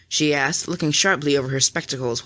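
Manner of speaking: plain reading voice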